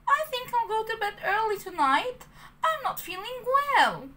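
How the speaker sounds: put-on high, childlike puppet voice; puppet boy voice. A narrator's reading. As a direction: play a nervous little puppet. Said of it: "Pinocchio said".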